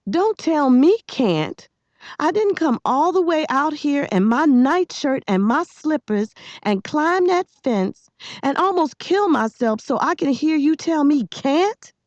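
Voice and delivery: high, outraged yell. A narrator's reading. Girl who climbed a fence refusing his can't, yelling. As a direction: protest at him, voice pitched right up. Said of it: "She was yelling".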